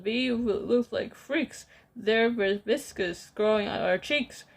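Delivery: usual speaking voice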